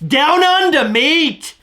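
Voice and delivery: high, hammed-up Aussie accent; playful put-on Australian twang; attempted Australian accent. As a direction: call it out in mock Australian. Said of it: "I called you mate, just like Australians".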